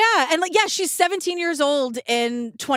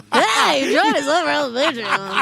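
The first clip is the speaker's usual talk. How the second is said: sixties radio DJ; 3 semitones higher, 3 dB louder, slower than usual. Retold or imitated. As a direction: pitch it like an old radio DJ. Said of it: "You just sounded like a radio D J from the sixties".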